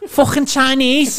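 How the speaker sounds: loud, high, stretched-out mock Scouse; Scouse accent. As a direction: exaggerate a Liverpool woman's Scouse, high and slow. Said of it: "one of them accents".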